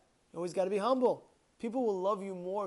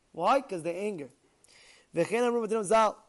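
normal talking voice

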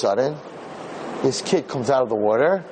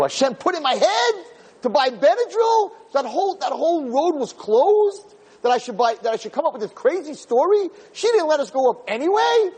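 much higher, wonder-struck telling; amazed; 12 semitones higher than usual. Real, not acted. Rabbi marvelling to his audience at God's plan, high and amazed. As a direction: lift the voice in awe at the miracle.

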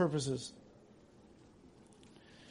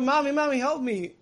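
put-on high, loud frightened child's cry; child screaming for help; 7 semitones higher, 3 dB louder than usual. Child at the doctor screaming to his mother, loud and high. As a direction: cry out to Mommy like a terrified kid. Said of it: "the kid's screaming out".

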